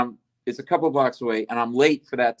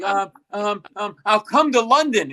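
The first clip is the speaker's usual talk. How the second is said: higher-pitched nervous ums, then a blurt; flustered stammer; 6 semitones higher, 4 dB louder than usual. Retold or imitated. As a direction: re-enact being tongue-tied and blurting an offer. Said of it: "I couldn't make eye contact".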